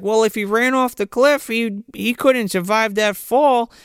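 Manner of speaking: put-on high little-girl voice, a touch slow; imitating the girl. Host mimics the girl at the cliff, high and worried. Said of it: "she even says".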